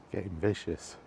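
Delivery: normal talking voice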